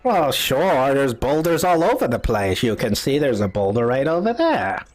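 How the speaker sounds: put-on old woman stating the obvious; old lady. Old lady shows travelers the boulders, old lady voice. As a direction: play the old hut dweller pointing things out. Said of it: "this old lady comes out".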